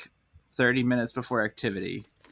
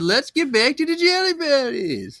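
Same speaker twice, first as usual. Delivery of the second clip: very high chipper host, laughing; sing-song guide through laughter; high kids-show guide; cheery tour guide; 7 dB louder than usual. Pretend.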